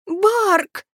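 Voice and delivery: high, frightened call; yell. A narrator's reading. Kelly fleeing the banshee, high yell for help. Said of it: "завопил Келли".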